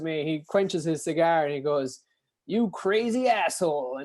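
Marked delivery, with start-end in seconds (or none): 2.5-4.0 slow, higher, drawn-out retold insult; mock incredulous verdict. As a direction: draw it out as the punchline. Retelling the biker's parting verdict.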